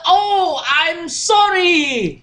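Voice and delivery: long, drawn-out high apology; mock frightened. Third prince after shooting the servant's heart, mock fright. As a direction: wail the apology slowly in hammed-up fright. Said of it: "wah, dia sangat takut. Dia kata".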